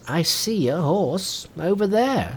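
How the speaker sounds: put-on high, slow kindly old man. A narrator's reading. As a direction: soothe him like a gentle elder. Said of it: "said the old man".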